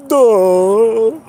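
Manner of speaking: loud, high, put-on dopey duh; sneering imitation of a dimwit; mocking duh. Mocking a viewer's dumb reasoning.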